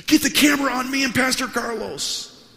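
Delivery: very high, loud showy shout; gushing famous pastor. Retold or imitated. Famous pastor greets visitor on camera, mock gushing. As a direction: holler a hammed-up welcome for the camera.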